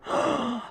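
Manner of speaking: a sharp soft gasp; gasp of awe. Real, not acted. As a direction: catch your breath at the view. First sight of the clouds.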